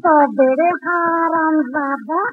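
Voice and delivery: slow, stretched high angry cry; drawn-out furious cry. Acted. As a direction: draw it out in rage. Priest lunges in rage.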